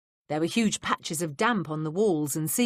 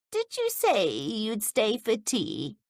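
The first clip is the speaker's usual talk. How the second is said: put-on quavery old woman; 4 semitones higher, slower than usual. A narrator's reading. Old Miss Bush voiced.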